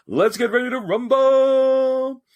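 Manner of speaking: high, drawn-out ring announcer call; Michael Buffer. Shown as a demonstration. Voice actor demonstrating a job for a charity event, ring announcer. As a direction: stretch it out like a boxing announcer. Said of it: "like Michael Buffer, the ring announcer in Vegas".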